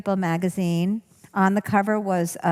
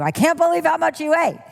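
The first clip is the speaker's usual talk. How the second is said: high, louder scolding jab; inner critic; 9 semitones higher, 4 dB louder, faster than usual. Shown as a demonstration. Superego scolding the eater, high and nagging. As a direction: nag about the food. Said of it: "Your superego is saying to you".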